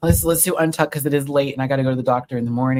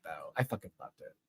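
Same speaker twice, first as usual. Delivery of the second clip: normal talking voice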